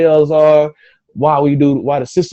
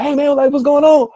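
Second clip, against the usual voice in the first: much higher, cheery imitated hello; friendly greeting; 4 dB louder than usual. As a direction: call out like a friendly stranger waving. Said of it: "he waving, like".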